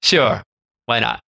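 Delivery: mildly weary, reluctant acceptance; resigned agreement. Retold or imitated. Restaurant man answering the TV host's question, imitated resignation. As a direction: give in slowly, as if after a sigh. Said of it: "he noticeably sighed and he said".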